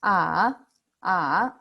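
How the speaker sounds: a long thinking "Ah" that sinks and climbs; third tone. Shown as a demonstration. Teacher demonstrates the hardest tone, dipping then rising. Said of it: "thinking sound".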